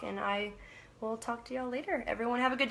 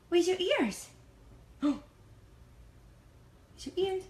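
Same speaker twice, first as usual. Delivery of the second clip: squeaky, high pet-talk voice; 9 semitones higher than usual. Real, not acted. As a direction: coo at the dog in a high voice. Baby talk to her dog.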